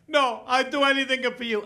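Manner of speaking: hammed-up motherly begging; pleading mother. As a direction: beg like a clingy mom. Mother begs son not to leave.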